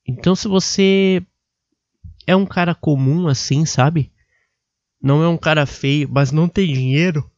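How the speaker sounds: normal talking voice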